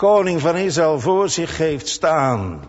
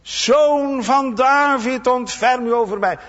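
high, loud, slow desperate cry; shouting louder; 8 semitones higher, 4 dB louder than usual. Retold or imitated. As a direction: cry out even harder for mercy. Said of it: "nog harder begint te schreeuwen".

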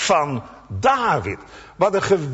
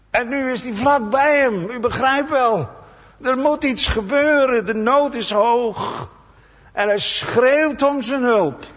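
preaching; 7 semitones higher than usual. Acted. Preaching at rising intensity.

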